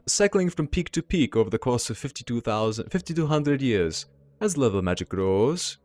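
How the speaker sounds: put-on measured German-accented dragon's representative. Dragon's envoy explains magic cycles to the group, slow German accent. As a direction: relish each word in a German accent. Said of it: "deep, melodious German accent".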